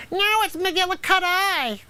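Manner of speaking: high, slow snooty mimicry of the player; mocking imitation. Coach mocks player's correction.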